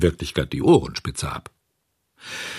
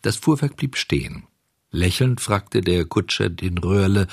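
ordinary delivery, nothing marked

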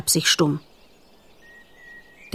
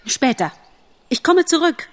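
high-pitched, urgent bargaining; desperate promise; 9 semitones higher than usual. Acted. Bambi bargaining with the old stag, desperate.

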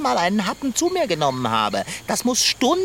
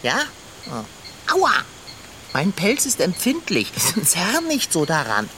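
usual speaking voice

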